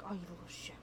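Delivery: whisper. Retold or imitated. Cat walks into feared bathroom.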